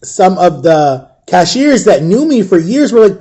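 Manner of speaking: plain reading voice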